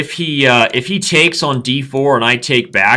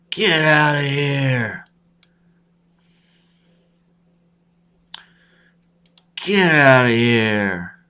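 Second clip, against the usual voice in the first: drawn-out; 5 semitones higher, much slower than usual. Acted. Drawn-out repeat of order.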